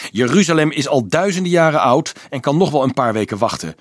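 irritated snap at the priest; snapping. A narrator's reading. Boy leader snapping back at the impatient shepherd, curt and irritated. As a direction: cut him off, fed up. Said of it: "snauwde Dolf".

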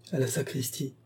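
very soft echo to oneself; low murmur. A narrator's reading. Adèle catching the clue in the housekeeper's words, murmuring. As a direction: murmur it under your breath. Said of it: "murmure tout bas Adèle".

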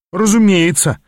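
a sarcastic snap of agreement. Acted. Apprentice snapping back sarcastically at his mentor. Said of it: "язвительно огрызнулся я".

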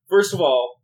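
ordinary talk

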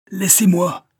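high, loud cry while struggling; struggling shout. A narrator's reading. Banker struggles against Verduret, high loud cry. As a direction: fight to get free. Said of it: "faisait-il en se débattant".

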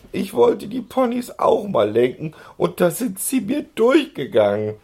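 very high, loud wail. A narrator's reading. Girl wailing her excuse to the policeman, very high. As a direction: sob out the excuse. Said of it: "heulte Lotte plötzlich laut auf".

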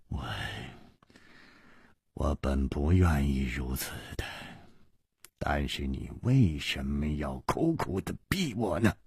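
low, soft, husky plea; hoarse voice. Acted. Nangong Ling excuses himself to his friend, hoarse. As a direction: force it out quietly, hoarsely. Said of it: "哑着声音说".

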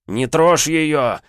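slow, loud, high furious bark; Leonid's bark. Acted. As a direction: bark it out, dragging each word. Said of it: "рявкнул он".

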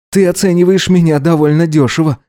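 understated scoff; snorting. A narrator's reading. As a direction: take slight offence, pitch held up. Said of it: "фыркнул он".

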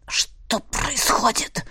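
rasping, breathless croak; barely voiced hoarse rasp. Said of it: "прохрипел он".

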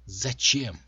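loud, exasperated outburst on the question; a restrained yell, not full force; mild shout. A narrator's reading. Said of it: "заорала она".

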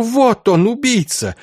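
high-pitched, anguished accusation; hateful cry. A narrator's reading. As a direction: point out the killer in a strained outcry. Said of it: "выкрикнул с ненавистью".

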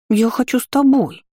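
put-on high pleading little girl; whiny child. A narrator's reading. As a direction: beg like a sulky child. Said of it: "недовольное личико".

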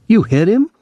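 high-pitched disbelieving question; incredulous. A narrator's reading. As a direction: ask in astonishment, pitch leaping up. Brother learns father was hit.

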